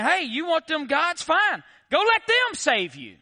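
high, loud sarcastic taunt; God. Acted. God teasing Israelites about their idols, sarcastic and loud. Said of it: "He's being sarcastic and he's being, he's being humorous".

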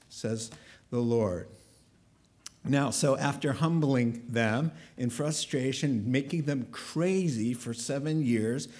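ordinary talk

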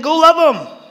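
high, loud mock command from God; insistent urging. God urging a reluctant introvert, in a high, insistent voice. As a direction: push it out high and emphatic.

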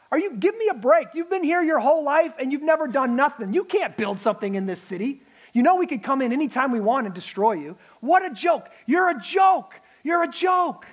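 mocking jeer. The enemy mocking Nehemiah's people, scornful jeer. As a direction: belittle them like a bully. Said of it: "He's making fun of them".

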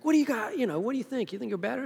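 mock indignation. Ticked-off disciples confront James and John.

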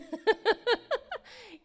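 a high, bright burst of laughter. Real, not acted. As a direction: laugh at yourself. Laughing after retold panic.

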